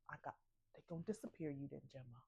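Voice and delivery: soft, fast ominous warning; low grim warning under the breath; grim hushed warning. Retold or imitated. Ralph warning Gemma of her fate, hushed.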